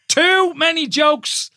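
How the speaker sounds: high, drawn-out exclamation; slow, emphatic protest. Real, not acted. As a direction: stretch every word in comic disbelief. Repeats it slowly for comic emphasis.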